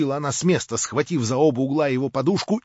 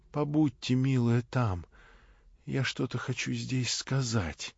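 слабый тихий голос; 6 dB quieter, slower than usual. Acted. Слабый больной говорит тихо.

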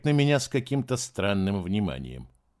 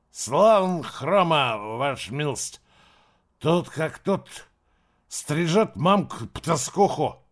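put-on slow, jeering drunk slur, higher; nasty drunk; 5 semitones higher, slower than usual. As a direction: sneer it out, slurring drunk. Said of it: "с теперь уже злобной ухмылкой".

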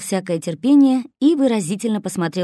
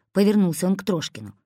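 plain reading voice

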